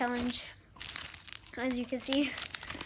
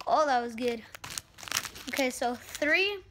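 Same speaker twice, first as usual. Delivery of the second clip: ordinary talk, nothing marked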